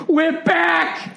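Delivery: high mock cheer; excited shout. Owner seeing his new billboard, high mock cheer. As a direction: yell it in wild joy. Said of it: "he flipped out".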